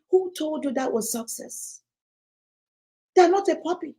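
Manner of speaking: ordinary talk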